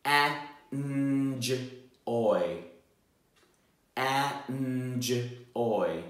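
En-j-oy segmented into En, j and oy; sounding out words. Shown as a demonstration. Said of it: "for me to sound out some words".

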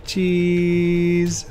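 high, long-held photo call; photo "cheese". Pretend. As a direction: hold the word high for the camera.

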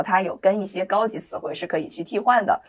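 ordinary talk, nothing marked

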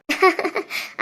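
soft little laugh; chuckle. Acted. Women fussing over the baby, soft laugh. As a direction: laugh quietly. Grandmother playfully warning the baby.

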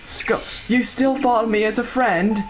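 slow, high British voice; British accent. Pretend. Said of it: "Skull Kid's British now".

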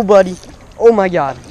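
normal talking voice

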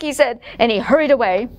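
plain reading voice

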